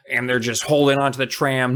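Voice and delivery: ordinary delivery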